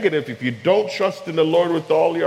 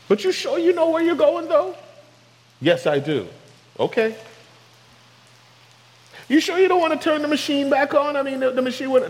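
much higher, whiny pestering voice; nagging doubter; 12 semitones higher than usual. Pretend. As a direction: pester God like a fretful passenger. Nagging believer doubts God.

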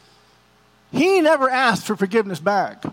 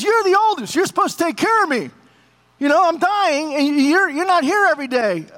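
higher, pressing father's demand; accusing father; 7 semitones higher than usual. Retold or imitated. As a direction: lean on him like a dying dad who wants more. Dying father guilt-trips his son.

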